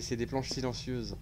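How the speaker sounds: normal talking voice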